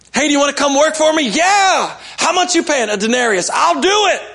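excited hiring exchange, hammed up; eager excitement. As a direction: play both sides with bouncy delight.

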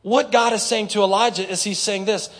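usual speaking voice

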